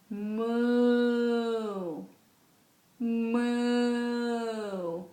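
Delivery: long, drawn-out pretend moos; cow moo. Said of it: "The cow says".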